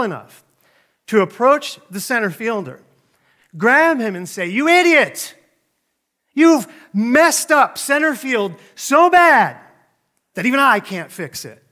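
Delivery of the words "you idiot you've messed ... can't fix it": loud, high mock tirade; frustrated outburst. Battered coach grabs the center fielder, frustrated outburst. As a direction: imitate a coach hollering, drawing out the insult. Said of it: "the coach gets really frustrated with him".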